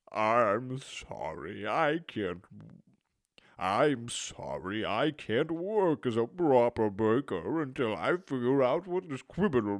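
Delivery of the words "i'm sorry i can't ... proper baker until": sad. Acted. Baker apologises, distraught.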